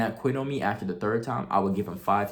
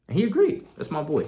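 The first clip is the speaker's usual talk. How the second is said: a higher, louder burst of delight; excited; 7 semitones higher, 4 dB louder than usual. Real, not acted. Telling the audience his boy agreed, excited. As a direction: cheer it like good news.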